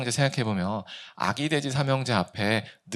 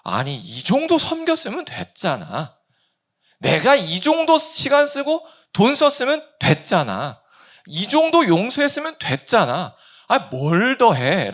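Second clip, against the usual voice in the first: higher-pitched, self-justifying protest; indignant complaint; 9 semitones higher, 4 dB louder than usual. Acted. Preacher voicing congregation's inner grumble, indignant complaint. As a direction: protest as if you've done more than enough.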